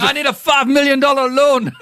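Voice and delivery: high, hammed-up Scottish ogre; Scottish accent, Shrek. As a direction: plead loudly in a put-on Scottish accent. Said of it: "trying to do a Scottish accent from Shrek".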